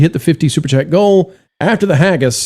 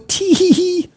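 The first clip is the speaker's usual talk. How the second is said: mock giggle; 14 semitones higher, slower than usual. Giggling at the packing name.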